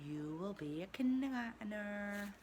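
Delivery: usual speaking voice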